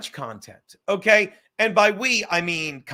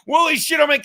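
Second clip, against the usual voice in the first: loud, higher, defensive whine of the friend; indignant retort; 8 semitones higher, 7 dB louder, faster than usual. Retold or imitated. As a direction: throw it back like a wounded friend. Friend's retort in retold argument.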